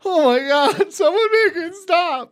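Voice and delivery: high, loud, laughing horror; mock horrified cry. Player choking a woman in first person, loud high horrified wail.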